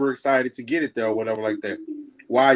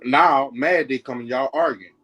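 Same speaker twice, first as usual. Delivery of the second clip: ordinary delivery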